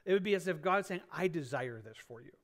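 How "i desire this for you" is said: softer, lower, gently encouraging. Shown as a demonstration. God's desire voiced to the men, softer and encouraging. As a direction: say it softly, like a loving wish. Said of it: "I want you to hear it that way".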